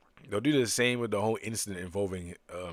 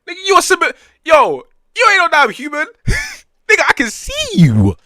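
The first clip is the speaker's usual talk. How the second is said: much higher and louder, laughing mid-yell; mocking shout; 24 dB louder than usual. Real, not acted. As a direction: holler at the screen in disbelief. Yelling at a nonhuman character.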